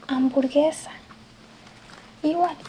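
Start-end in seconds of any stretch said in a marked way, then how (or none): 0.1-0.9 Spanish "hamburguesa"; Spanish word. Shown as a demonstration. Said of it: "es casi igual en español".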